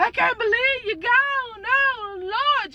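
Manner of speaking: high, overdone grieving cry; mock wailing mama. Imitating mama making a scene at the funeral, high mock wail. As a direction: ham up a fake mourner's wail. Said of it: "Just being so extra".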